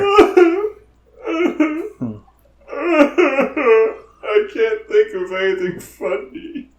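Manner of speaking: high squeaky voice through tears of laughter; can barely get words out laughing; crying with laughter. Real, not acted. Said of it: "footage of me crying".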